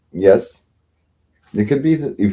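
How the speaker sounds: usual speaking voice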